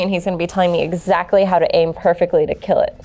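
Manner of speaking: ordinary talk, nothing marked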